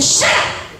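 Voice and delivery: imitating a guest scolding a noisy child. Guest scolds the shouting little child, imitated angry scolding. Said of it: "and he said".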